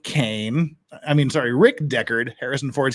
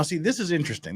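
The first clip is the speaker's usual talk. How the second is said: ordinary talk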